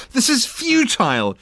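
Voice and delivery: high, loud and slow outburst; exclaimed. Acted. Ford exasperated with the useless committee, exclaiming loudly. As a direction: throw up your hands in exasperation. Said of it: "he exclaimed".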